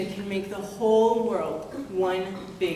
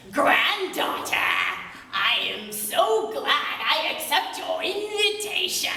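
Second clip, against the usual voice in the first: giant Chenu; 5 semitones higher than usual. Acted.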